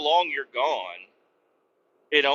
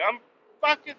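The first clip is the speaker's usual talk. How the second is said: much higher put-on voice, exasperated; squeaky protest; 12 semitones higher than usual. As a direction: insist you speak English, squeaky. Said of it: "I'm like".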